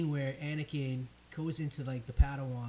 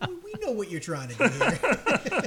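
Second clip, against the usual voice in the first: high, loud laughing jab; laughing mock; 9 semitones higher, 6 dB louder than usual. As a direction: laugh it out at the filmmakers. Mocking the filmmakers, laughing.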